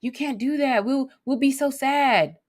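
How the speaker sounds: high pleading mock dismay; guilt-tripping plea. Shown as a demonstration. Counselor demoing a guilt-tripping response, high pleading. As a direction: beg them not to, a little whiny. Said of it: "judgment might even sound like this".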